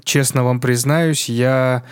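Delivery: normal talking voice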